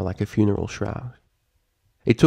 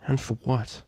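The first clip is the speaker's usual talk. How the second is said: quiet despair; 4 semitones higher, 8 dB quieter than usual. A narrator's reading. Despairing narration.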